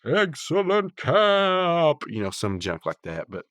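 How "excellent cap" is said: high, loud, drawn-out announcement; stinger voice. Shown as a demonstration. Podcaster mocking up a stinger for the episode, high and drawn out. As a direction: stretch it out like a show stinger. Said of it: "like, a stinger and be like".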